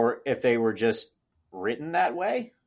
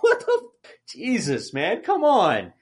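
laughing disbelief; 5 semitones higher than usual. Retold or imitated. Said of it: "I'm like".

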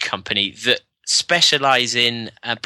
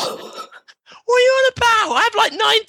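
hammed-up builder, much higher pitched and indignant. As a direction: protest like a cartoon tradesman. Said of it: "sort of stereotypical against builders".

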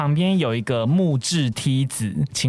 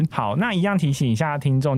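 ordinary delivery

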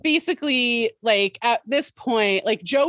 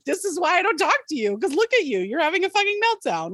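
exasperated retort; 8 semitones higher, faster than usual. Retold or imitated. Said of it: "I, like".

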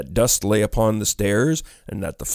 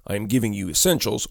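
plain reading voice

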